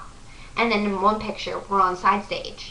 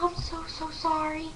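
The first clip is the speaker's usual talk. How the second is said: high, soft voice imitating the singer; small, raised-pitch cooing apology; retold sympathetic apology; 8 semitones higher, 6 dB quieter than usual. Singer hears the nosebleed story, high soft apology.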